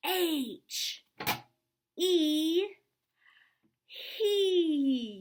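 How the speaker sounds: high, loud flexing shout of each letter; tough muscle voice. Shown as a demonstration. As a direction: pump up your muscles and belt it out. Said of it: "One more time".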